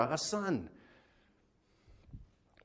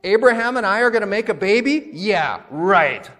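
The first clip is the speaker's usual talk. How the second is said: high, loud incredulous question, dropping into sarcasm; mock the idea with a scoffing "yeah, right"; cynical disbelief; 7 semitones higher, 9 dB louder than usual. Sarah scoffing at having a baby, sarcastic. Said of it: "it is not a joyous laughter".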